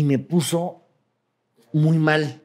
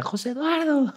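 retold mock yell; 11 semitones higher, 5 dB quieter than usual. Group of girls calling out to him, mock high-pitched yell. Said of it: "me grita".

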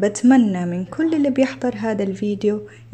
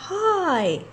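Sfroota's greeting pitched higher; cartoon girl greeting; 8 semitones higher, much slower than usual. Teacher voicing Sfroota greeting the girl, higher cartoon voice.

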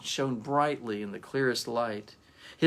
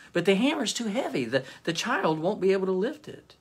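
anxious pleading; 7 semitones higher, faster than usual. Acted. Gaspar pleads with the angel.

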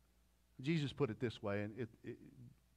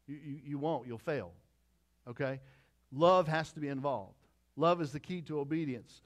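ordinary talk, nothing marked

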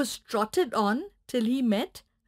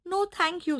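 higher polite puppy no; puppy refusing grass; 7 semitones higher than usual. Acted. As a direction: politely turn it down. Puppy declines.

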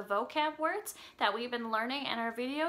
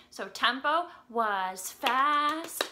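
ordinary talk, nothing marked

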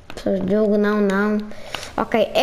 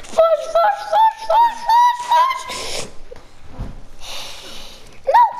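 very high, frantic repeated cries; panicked squealing. Real, not acted. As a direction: squeal for escape in a gaming panic. Fleeing fish in the game.

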